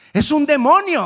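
high, loud cry of alarm; hammed-up frightened exclamation; alarmed disciple. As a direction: blurt it out in acted fright. Disciples alarmed at figure on water.

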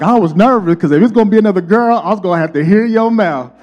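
high, loud, fast relief; wife teasing husband after the scan; relieved wife. Retold or imitated. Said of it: "She's like".

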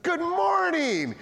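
very high, drawn-out good morning; hammed-up, slow, high-pitched hello; over-excited greeting. Retold greeting after the shock.

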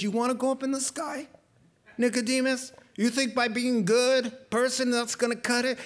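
a hint of Jesus pressing Nicodemus; lightly voiced challenging Jesus. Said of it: "Jesus says".

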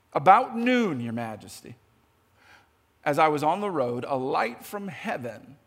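reading scripture aloud, measured and low. Acted. Preacher reading Paul's story to the church. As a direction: read Paul's words like a testimony.

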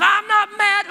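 high, loud mock outburst; hammed-up yell that betrays the denial; angry denial. Sizzling husband to wife asking why he got mad, angry denial. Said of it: "finally you calm down a little bit".

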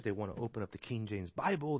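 ordinary talk

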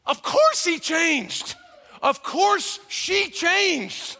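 slow, high, drawn-out "of course"; stretched, insistent preaching; emphatic. Real, not acted. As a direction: hammer each word slowly. Preacher hammers the point.

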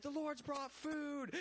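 very high, very slow, rapturous villager voice; joyful locals. Retold or imitated. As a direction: drag it out in awed delight. Locals praising God, voiced.

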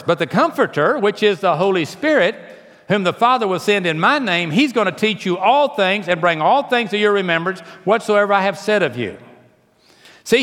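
high, loud, driving sermon climax; preaching at full force. Acted. Preacher urging the congregation, high and loud. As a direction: preach it hard, rising and pounding.

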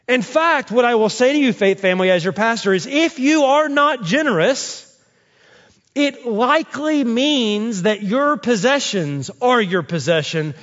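high-pitched, urgent pulpit delivery; forceful preaching. Real, not acted. Pastor challenges his church to be generous, forceful preaching. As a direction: drive the point home to the congregation.

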